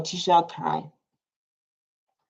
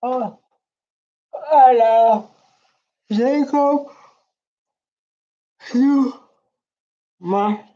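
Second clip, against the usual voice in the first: gushing declaration; 5 semitones higher, 4 dB louder, much slower than usual. Pretend. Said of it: "I wanted to say, like".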